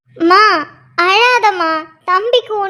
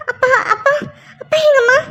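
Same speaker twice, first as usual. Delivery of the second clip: ordinary delivery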